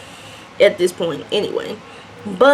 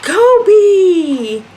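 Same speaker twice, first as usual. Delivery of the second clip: louder, high, drawn-out holler; calling out; 9 semitones higher, 5 dB louder than usual. Retold or imitated. Stranded girl calling her friend out of the house, hollering. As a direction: holler the name long, falling away at the end. Calls his name a second time.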